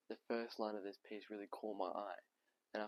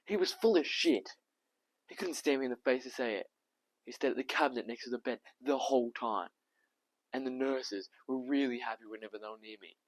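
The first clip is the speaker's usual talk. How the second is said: loud, raised outburst easing toward the end; Tom, angry; 3 semitones higher, 10 dB louder than usual. Acted. Tom exposing the doctor who avoided his eyes, angry outburst. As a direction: burst out in indignation at being lied to. Tom's bitter outburst in monologue.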